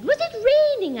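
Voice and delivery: high, prim and innocent; prim schoolgirl. Retold or imitated. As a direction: ask it sweetly, all innocence. Her quoted question.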